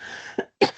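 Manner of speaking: real wet cough; rough cough away from the mic; chesty cough. Real, not acted. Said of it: "I've got a cough".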